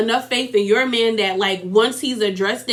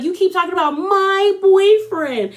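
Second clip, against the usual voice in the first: much higher, a bit louder, strained; Teresa's high pitch; 7 semitones higher, 3 dB louder than usual. Retold or imitated. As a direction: mimic a friend's shrill complaint. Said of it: "When she gets that high pitch".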